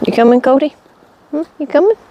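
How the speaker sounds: high, rising coaxing question; real high-pitched dog call. Real, not acted. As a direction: call your dog to come along. Calling the dog Cody to come along.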